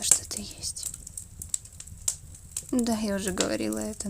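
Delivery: ordinary talk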